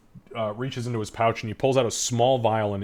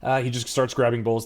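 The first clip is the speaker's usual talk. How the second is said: ordinary talk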